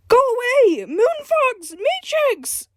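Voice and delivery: high panicked shout; shouting for help. Acted. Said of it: "Garashi shouted for help".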